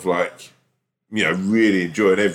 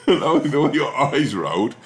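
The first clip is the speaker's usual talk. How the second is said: slow laughing words; 4 semitones higher, slower than usual. Real, not acted. Mocking the co-host's eye roll while laughing slowly. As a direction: drag the words out through giggles. Cracking up at co-host.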